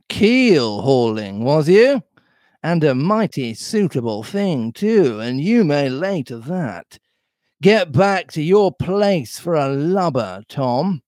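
drawn-out scornful echo, rising on the question; scolding pirate Silver. Acted. Silver sending Tom back to his place, scornful. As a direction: stretch "Keel hauling" out, then dismiss him.

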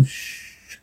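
whispered, teasing shh; soft mischievous shush between lovers; playful shush. A narrator's reading. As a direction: hush them with a playful whisper.

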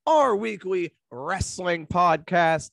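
upbeat host announcing the show; slow, hyped-up welcome; podcast intro voice. Acted.

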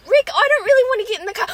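put-on high, fast whining boy; whiny kid voice. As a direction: whine like a scared little boy. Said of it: "Don't you remember that one?".

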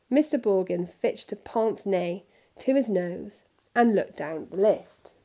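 usual speaking voice

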